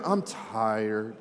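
low, soft droning sigh of a tired twenty-something; mock tired young person. Young people answering the pastor, low and drained. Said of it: "Anybody, like, under thirty".

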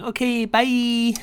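high, slow, singsong brush-off; chirpy dismissal. Retold or imitated. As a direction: wave him away brightly. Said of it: "we just kept going".

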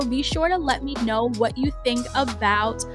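usual speaking voice

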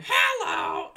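put-on high loud baby squeal; squeaky baby voice. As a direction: squeal like a newborn. Voicing a newborn baby.

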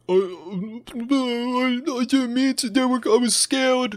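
high, mocking whiny cry of fake fear; mock whimpering. Mocking the shooter crying on the witness stand, high whiny mock sob. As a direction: imitate a boy whimpering on the stand. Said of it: "he cried. He was like".

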